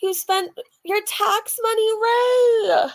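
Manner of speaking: a high, loud cry of the name, half laugh, half sob; laughing crying shout. Real, not acted. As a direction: call the name out, laughing through tears. Overwhelmed by friend's gift.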